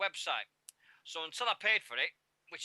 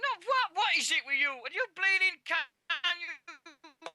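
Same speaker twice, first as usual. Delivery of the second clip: much higher and louder, exasperated protest; exasperated outburst; 6 dB louder than usual. Real, not acted. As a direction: snap at the co-host in disbelief, pitched way up. Annoyed outburst at co-host.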